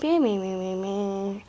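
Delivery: repeated chime noise made with the voice; sing-song bell imitation; imitated door bell. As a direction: mimic a jangling door bell. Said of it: "a bell every time you open it".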